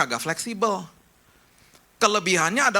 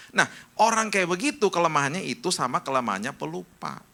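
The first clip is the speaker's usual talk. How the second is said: ordinary talk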